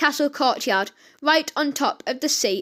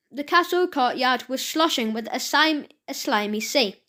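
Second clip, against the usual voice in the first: ordinary delivery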